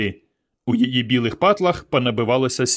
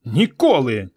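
emphatic, mildly raised cry; defiant cry; slower than usual. A narrator's reading. Varka answers her mother through the door, crying out. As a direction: cry out a refusal. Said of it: "крикнула Варка".

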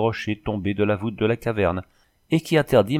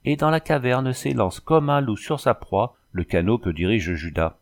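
plain reading voice

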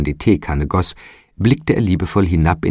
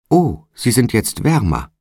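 put-on child calling out; 6 semitones higher than usual. A narrator's reading. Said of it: "rief das Kind".

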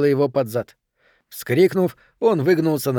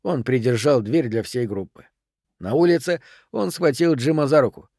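ordinary delivery

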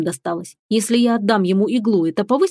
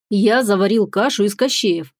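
ordinary delivery

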